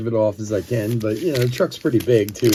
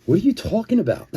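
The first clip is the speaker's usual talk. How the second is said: incredulous; 8 semitones higher, faster than usual. Retold or imitated. Confused by other driver's excuse.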